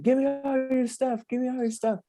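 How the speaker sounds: high barked mock demand; robber demand. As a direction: bark it like a robber, high-pitched. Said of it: "And they're like".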